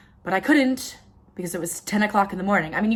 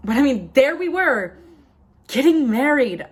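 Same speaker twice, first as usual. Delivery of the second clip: higher, louder disbelief; raised, emphatic amazement; incredulous; 5 semitones higher, 5 dB louder than usual. Acted. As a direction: exclaim it as if you still can't believe it. Repeats wedding in disbelief.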